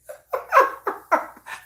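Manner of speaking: breathy laugh shooting way up; high airy laugh. Real, not acted. Amused at her own whining, airy laugh.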